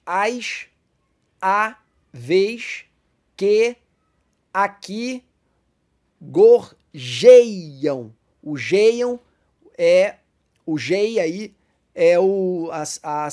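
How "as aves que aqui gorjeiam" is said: "as aves que aqui gorjeiam" with a pause after each word and "gorjeiam" punched loud; counting syllables. Shown as a demonstration. Teacher scanning the verse for the class, word by word. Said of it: "Nós vemos também na seguinte".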